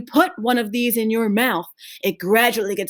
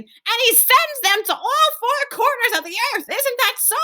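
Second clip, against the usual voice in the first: put-on high old woman voice. Grandma Josephine continues.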